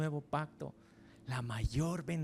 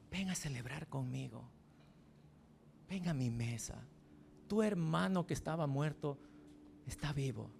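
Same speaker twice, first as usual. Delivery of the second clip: pleading father; 2 semitones lower, 7 dB quieter than usual. Acted. The father pleading with the older son to come celebrate, tender. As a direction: coax him gently to the table. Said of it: "rogándole al hijo mayor".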